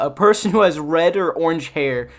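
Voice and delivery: ordinary talk, nothing marked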